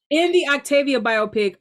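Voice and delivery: usual speaking voice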